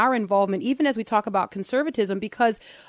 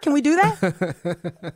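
laughing; much slower than usual. Real, not acted. Joking about grand title.

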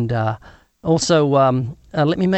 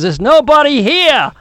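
high, loud and slow lady's voice; mock woman's voice calling out; TV character line; 11 semitones higher, 9 dB louder, slower than usual. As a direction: put on the sitcom lady, slow and high. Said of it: "she says".

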